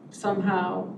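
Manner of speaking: ordinary delivery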